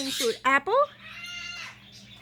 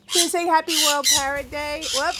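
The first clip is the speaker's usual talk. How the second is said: normal talking voice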